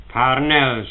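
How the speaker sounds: ordinary delivery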